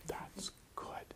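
hushed whisper of approval; very soft, unvoiced aside; whispered admiration. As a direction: whisper it, quietly impressed. Acts out awed onlooker.

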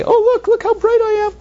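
high, loud mock boast; boastful pants. Teacher voices bright pants to students, high mock boast. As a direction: squeal like showy pants. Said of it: "do the pants scream out and say".

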